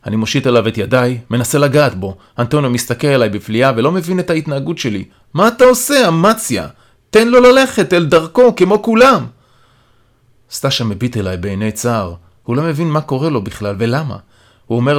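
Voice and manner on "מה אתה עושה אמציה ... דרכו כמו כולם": high, raised-voice protest; remonstrating. A narrator's reading. Antonio, baffled, tells Amatzia to stop interfering, remonstrating. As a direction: challenge him, drawing the words out.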